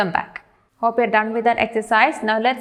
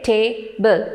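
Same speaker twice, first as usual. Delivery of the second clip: "table" slowly split into two separate syllables. Shown as a demonstration. Teacher showing learners two syllables, "table" split slowly. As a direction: say "table" slowly as ta, ble, with a gap. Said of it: "two syllables".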